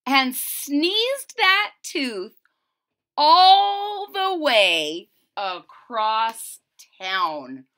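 slow, loud drawn-out telling; dramatic stretch. A narrator's reading. Narrator showing how far the tooth flew, drawn out.

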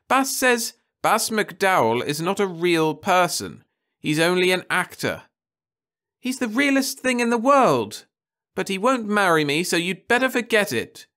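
high, loud sulky insistence; stubborn girl. A narrator's reading. Daughter defending her TV idol to her mother, high and sulky.

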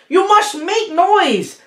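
high, slow, pressing call; urgent call. Acted. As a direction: stress must, urging them on. Papagayo urging the creatures.

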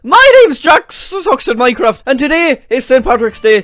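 high-pitched, hammed-up Irish greeting; mock Irish accent. Leprechaun host opens a Saint Patrick's Day video, mock Irish accent. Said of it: "talking in an Irish accent".

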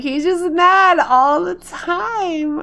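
high, loud, drawn-out grumble; exasperated complaint. Retold or imitated. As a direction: complain loudly, stretching the words out slowly. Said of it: "So I'm just like".

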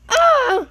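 sudden high loud yelp. Acted. Player yelping at the fake ending. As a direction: let out a sharp yell. Outburst after crying.

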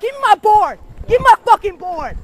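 loud, demanding yell; angry shout. Real, not acted. Kid yelling at a man for his skateboard. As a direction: shout it at him, repeating.

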